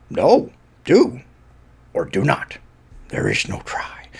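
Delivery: whispered Yoda wisdom. Retold or imitated. Fan redoing Yoda line as a reverent whisper. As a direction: breathe the line like a sage. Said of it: "the best Yoda line".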